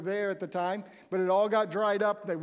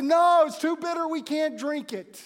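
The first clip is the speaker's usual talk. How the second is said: disgusted outcry; 7 semitones higher, 6 dB louder than usual. Retold or imitated. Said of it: "spit it out of their mouth and said".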